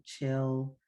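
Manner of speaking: ordinary talk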